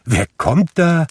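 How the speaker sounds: high, surprised question; astonished calling. A narrator's reading. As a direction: call out amazed to see who is there. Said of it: "rief Fred".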